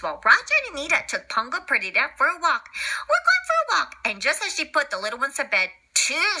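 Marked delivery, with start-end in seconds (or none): 3.1-4.0 much higher cheerful call; high sing-song. Acted. Anita calls to the pups about their walk, high cheerful call.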